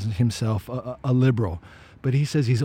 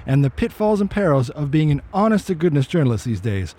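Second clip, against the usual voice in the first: lively, slightly raised teaser read; show intro announcer; 5 semitones higher than usual. Acted. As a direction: sell what's coming up on the show. Reads the show's opening teaser.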